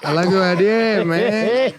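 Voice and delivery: put-on a cartel guy approving, Mexican accent. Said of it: "our Mexican accents sound Indian".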